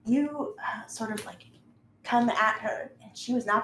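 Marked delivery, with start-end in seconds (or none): none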